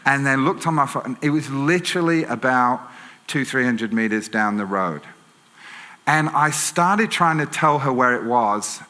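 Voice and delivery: ordinary talk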